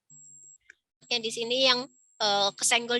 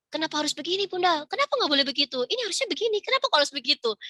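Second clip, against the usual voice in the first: put-on questioning child; 6 semitones higher, faster than usual. Analytical child questions mother.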